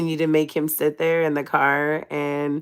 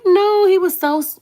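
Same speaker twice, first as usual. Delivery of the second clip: excited grandma; 14 semitones higher, 7 dB louder than usual. Retold or imitated. Retelling grandma on the phone, excited high imitation. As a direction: squeal it as a delighted grandma would.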